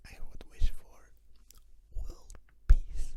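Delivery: hammed-up pageant answer, whispered; beauty pageant contestant. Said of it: "gonna sound like a beauty pageant contestant".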